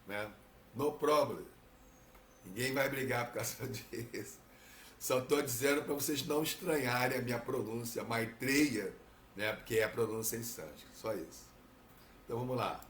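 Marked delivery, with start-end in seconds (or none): none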